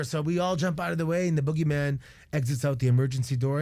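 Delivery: normal talking voice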